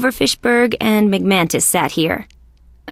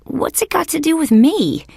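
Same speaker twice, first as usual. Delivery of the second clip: high, incredulous question; exasperated bafflement; 10 semitones higher than usual. Acted. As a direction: demand an answer, voice pitched up. Baffled by symbol in own case.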